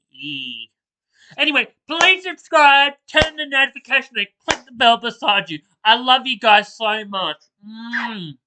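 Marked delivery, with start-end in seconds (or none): 1.4-8.4 high, loud, bubbly sign-off; upbeat vlog outro. Acted. Youtuber asking viewers to subscribe, hyped and bright. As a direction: pump up the energy for the goodbye plea.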